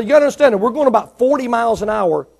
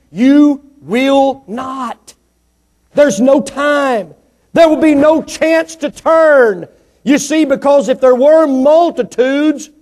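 higher and louder, hammering each line; full-force preaching; 6 semitones higher, 4 dB louder, much slower than usual. Acted. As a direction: preach it hard to the congregation.

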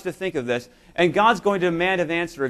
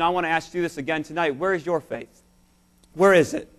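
usual speaking voice

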